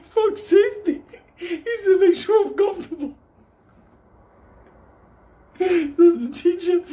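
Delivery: high-pitched, loud laughing squeal; wheezing high laugh through the punchline; laughing hard. Real, not acted. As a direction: crack up while forcing out the words. Cracking up at his own story.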